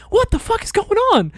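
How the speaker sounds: very high, loud freak-out; retold panic. Nervous recruit waiting outside the blood room, high-pitched mock panic. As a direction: play up the rising alarm.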